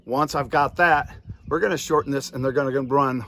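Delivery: usual speaking voice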